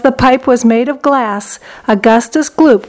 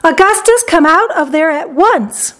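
high, insistent motherly command; yelling; 6 semitones higher than usual. A narrator's reading. Mother orders her stuck son out, yelling. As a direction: call him out, pitch raised. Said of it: "yelled Missus Gloop".

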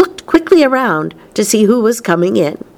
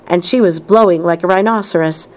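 plain reading voice

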